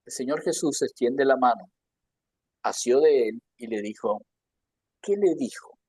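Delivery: ordinary talk, nothing marked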